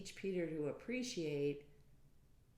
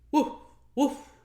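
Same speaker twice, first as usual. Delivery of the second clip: woof woof barked high and loud; dog bark; 10 semitones higher, 16 dB louder than usual. Acted. Reading to a child, a barking dog. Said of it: "And the dog said".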